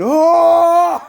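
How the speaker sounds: high, loud whoop; excited shout. Real, not acted. Hyping the family power-up to friends, with a loud whoop. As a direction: burst out in hype.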